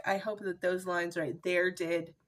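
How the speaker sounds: normal talking voice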